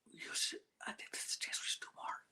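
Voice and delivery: fast hushed plotting whisper; quick secret whisper. Retold or imitated. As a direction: rush it out in a whisper.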